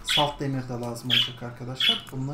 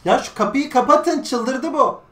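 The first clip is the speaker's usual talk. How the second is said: yüksek ve tiz seslenme; kapıyı kapatsınlar diye bağır; bağırarak; 9 semitones higher, 10 dB louder, slower than usual. Real, not acted. Evdekilere bağırma. (evdekilere kapıyı kapatın diye bağırış)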